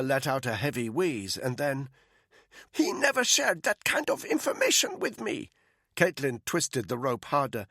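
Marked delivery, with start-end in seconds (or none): 2.7-5.5 higher, breathy-free tense woman's voice, half whispered at first; a frightened captive pleading. Acted. Frightened prisoner answering her captors, tense higher woman's voice.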